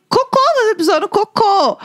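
high, half-laughing alert; alarmed warning. Retold or imitated. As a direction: call out the mishap in mock alarm.

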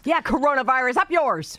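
high, loud, defiant jeer; defiant shout. Real, not acted. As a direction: yell it at the virus. Defiant cursing at the virus.

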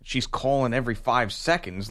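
normal talking voice

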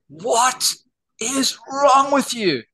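high, slow, drawn-out mock scolding; mock exasperated wife. Wife on the phone scolding husband over boxes, slow high mock exasperation. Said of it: "she goes".

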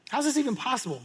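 mildly heated, baffled protest; acted sharp argument. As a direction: challenge it in acted disbelief. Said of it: "They began to argue sharply".

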